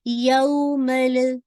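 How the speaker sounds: यौमल with the lam bounced; wrong bouncing lam. Shown as a demonstration. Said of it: "ऐसे नहीं पड़ेगा".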